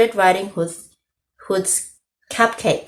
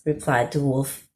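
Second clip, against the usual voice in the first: usual speaking voice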